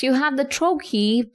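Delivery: usual speaking voice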